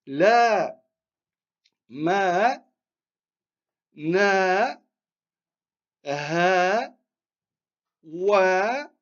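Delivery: each of "La, ma, na, ha, wa" held long and separate; drawn-out long vowels. Shown as a demonstration. Teacher modelling lengthening for students, long held "a" in "La, ma, na, ha, wa". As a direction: stretch the "a" in "La", "ma", "na", "ha", "wa". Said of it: "Donc on allonge".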